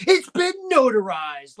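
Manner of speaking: high, loud, drawn-out announcement; mock proclamation. Host pitches Logan's missed joke, loud mock proclamation.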